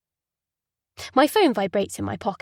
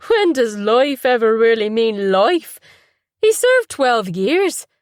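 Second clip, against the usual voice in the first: brother's voice; 8 dB louder, slower than usual. Acted. Brother pressing his case.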